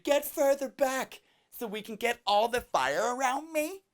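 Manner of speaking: high, put-on selfie-girl voice; teenage girl imitation. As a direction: pose for a photo, hammed-up teen. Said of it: "if she wanted me to take a picture".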